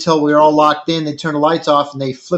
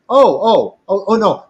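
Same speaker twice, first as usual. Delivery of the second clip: higher and louder, playing her alarm; mock shocked grandma; 5 semitones higher, 3 dB louder than usual. Old lady at induction center hears marijuana, mock alarm. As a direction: voice the old lady's startled dismay.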